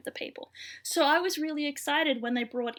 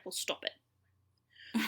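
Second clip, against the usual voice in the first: deadpan; 7 dB quieter, much slower than usual. Retold or imitated. Hen's deadpan reply to Buck.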